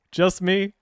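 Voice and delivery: relieved. Real, not acted. Relieved after the startle.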